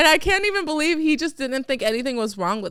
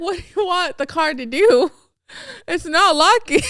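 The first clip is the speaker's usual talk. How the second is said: exasperated; 6 semitones higher, 4 dB louder than usual. Retold or imitated. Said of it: "I was like".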